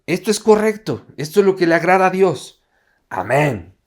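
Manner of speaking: loud, high-pitched avowal, dropping to a firm amen; convinced declaration. Acted. Believer affirming what pleases God, acted conviction. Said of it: "tú puedes estar convencido".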